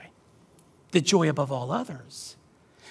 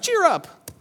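glib advice; 13 semitones higher, 5 dB louder than usual. Retold or imitated. Voicing glib advice to depressed person.

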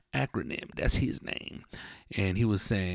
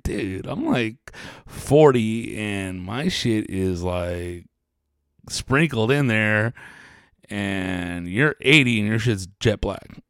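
mock incredulous; much slower than usual. Imagined reply to old dude.

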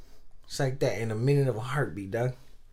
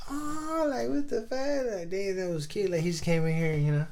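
mocking cheerleaders; 9 semitones higher than usual. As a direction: mimic a gushing cheerleader, way up high. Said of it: "all the cheerleaders were like".

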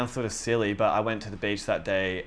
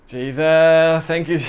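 1 joyful praise; 6 semitones higher, 11 dB louder, slower than usual. Retold or imitated. Joyful praise over broken phone.

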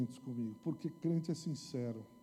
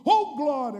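mocking exclamation; 11 semitones higher, 14 dB louder, slower than usual. Mocking empty praise.

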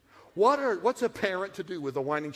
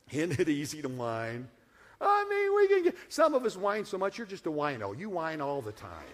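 normal talking voice

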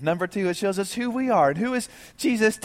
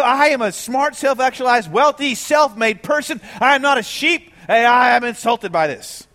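higher, louder indignant protest; mock the offended listener; indignant objector; 4 semitones higher, 6 dB louder than usual. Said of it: "You know".

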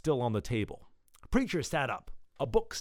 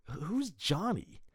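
groggy question; 6 semitones higher, much slower than usual. Acted. Groggy character tottering up asks.

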